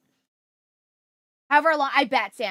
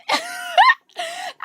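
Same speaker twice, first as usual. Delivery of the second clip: high, loud burst of laughter; bright cackle of amusement; laughing; 8 dB louder than usual. Real, not acted. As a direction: crack up at your own impression. Laughs at own toddler impression.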